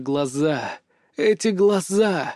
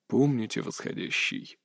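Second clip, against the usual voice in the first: unvoiced rustling whisper, very soft; 6 semitones lower, 9 dB quieter than usual. A narrator's reading. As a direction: breathe it out like dry leaves stirring. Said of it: "прошелестел голос".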